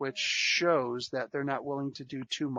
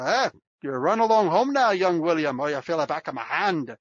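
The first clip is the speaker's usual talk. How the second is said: loud Braveheart-style Scottish brogue; Scottish accent; 3 semitones higher, 7 dB louder than usual. Retold or imitated. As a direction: bark it like a Scottish warrior in Braveheart. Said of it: "learn how to do it with a heavy Scottish accent".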